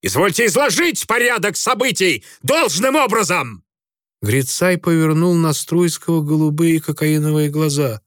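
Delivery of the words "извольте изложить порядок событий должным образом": indignant officer. A narrator's reading. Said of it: "не выдержал".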